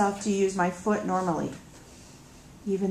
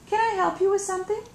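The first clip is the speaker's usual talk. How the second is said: wary politeness; 12 semitones higher, much faster than usual. Acted. Confronting unexpected peacekeepers at home.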